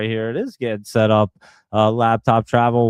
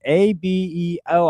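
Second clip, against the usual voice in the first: A B E O said slow, letter by letter, pitched higher; slow it down so they catch each letter of A B E O; each letter of A B E O drawn out, voice lifted; spelled out slowly; 7 semitones higher than usual. Shown as a demonstration. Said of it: "I'll say it slow for you".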